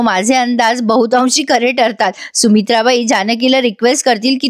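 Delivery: ordinary talk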